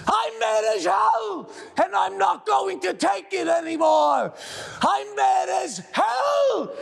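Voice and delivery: furious rallying yell. Acted. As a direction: yell it out the window. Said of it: "Stick your head out and yell".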